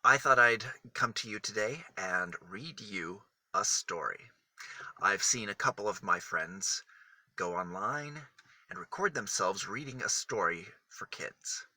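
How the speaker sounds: plain reading voice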